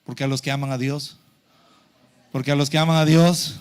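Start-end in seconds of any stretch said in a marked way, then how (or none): 2.3-3.6 verse called out loudly, pitched low; loud, declamatory scripture prompt; loud recitation. Real, not acted. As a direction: lead the congregation in the verse at full volume. Repeats verse louder for congregation.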